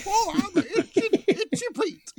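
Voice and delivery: put-on hammy old gold-miner stripper; old prospector. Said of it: "grizzled prospector themed strip show".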